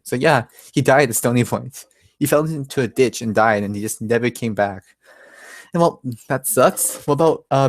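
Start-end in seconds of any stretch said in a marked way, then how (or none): none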